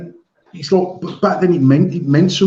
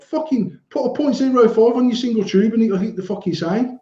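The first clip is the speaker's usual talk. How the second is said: exasperated retort; 8 semitones higher than usual. Retold or imitated. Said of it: "I'm like".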